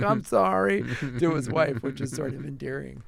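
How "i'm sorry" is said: much higher, pleading little apology; hammed-up contrite husband at the door; mock sheepish Churchill. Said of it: "yelling at generals ... is like".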